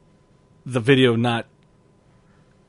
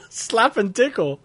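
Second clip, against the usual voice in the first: high, slow, amused echo of slap; amused repeat; 9 semitones higher, slower than usual. Real, not acted. Host echoes his cohost's quaint phrase, amused and high. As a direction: savor the silly phrase.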